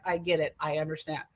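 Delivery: ordinary talk, nothing marked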